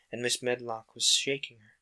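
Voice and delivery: usual speaking voice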